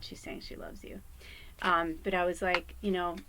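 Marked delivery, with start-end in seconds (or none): 0.0-1.0 whisper. Real, not acted. Dog sending love.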